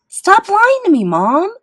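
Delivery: very high, loud shriek. A narrator's reading. Boy rejecting his mother's denial, shrieking. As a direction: shriek it in fury. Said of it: "I shrieked".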